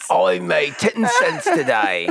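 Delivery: slow, high Cockney brag; Cockney chimney sweep. Pretend. Sweep reporting the day's earnings, high slow Cockney. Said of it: "Cockney eighteen eighties chimney sweep".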